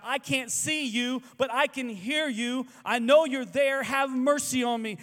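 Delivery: high, loud, slow desperate cry for mercy; screaming blind man. Acted. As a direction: scream out to someone you cannot see. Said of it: "A blind man screams".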